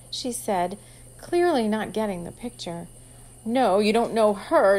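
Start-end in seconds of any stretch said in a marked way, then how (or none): 3.4-4.8 louder and slow, leaning hard on "her"; emphasis on her. Acted. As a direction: make sure she hears the word "her". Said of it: "his emphasis on the word her".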